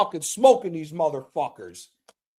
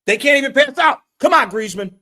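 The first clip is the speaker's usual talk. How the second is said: frustrated shout; 7 semitones higher, 8 dB louder than usual. Real, not acted. Frustrated at team's passing.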